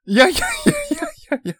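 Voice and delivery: high, loud disbelieving no no no; surprised protest. Real, not acted. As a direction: shoot up in shocked disbelief. Objects to absurd size. (驚いて高い声で否定)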